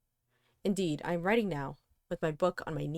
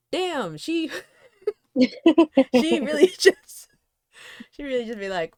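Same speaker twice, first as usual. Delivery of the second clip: laughing; 8 semitones higher, 10 dB louder, slower than usual. Real, not acted. Laughing at the reading.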